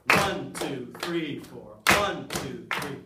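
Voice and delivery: loud count-in with one accented; teacher counting the beat for clapping; counting in the class. Shown as a demonstration. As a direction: call out the count for the class to clap. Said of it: "Should we try it? Ready?".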